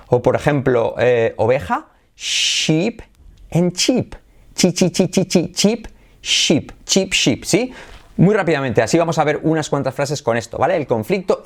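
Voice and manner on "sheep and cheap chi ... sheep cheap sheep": "Sheep" with long sh, "cheap" with crisp ch; high repeated "chi" between the contrasted words; sheep versus cheap. Shown as a demonstration. Teacher drilling S H against C H, exaggerated contrast. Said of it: "diferenciando la S H y la C H".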